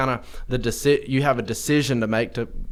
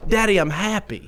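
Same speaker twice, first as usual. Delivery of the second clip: put-on higher, bright toddler voice; happy little boy; 8 semitones higher than usual. Dad imitating his toddler cheering up, high and chirpy. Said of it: "turn his frown upside down and go".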